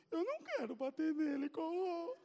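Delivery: high, tearful whine; mock-crying kid; crying plea. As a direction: beg through sobs like a small boy. Crying boy refuses to hit.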